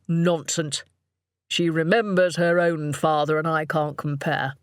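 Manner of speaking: scornful husband's voice; dismissive snort. A narrator's reading. Husband disputing his wife's view, dismissive. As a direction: wave it away as nonsense. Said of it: "Richard would snort and say".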